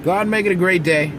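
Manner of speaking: bright, loud, high pep line; upbeat sign-off. Real, not acted. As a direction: send viewers off pumped up.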